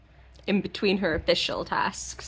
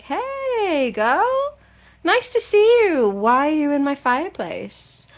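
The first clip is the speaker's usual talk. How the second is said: much higher, sing-song flirty greeting; flirty Rhysand; 9 semitones higher, 4 dB louder, slower than usual. Retold or imitated. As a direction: tease her like a smug charmer. Rhysand flirts, voiced by teller.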